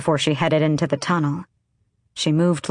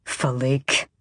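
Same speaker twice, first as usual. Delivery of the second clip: low-voiced growled surname; 3 semitones lower than usual. A narrator's reading. Kayal calls the lingering young woman to order, growled. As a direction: growl the name as a warning. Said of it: "Kayal growled".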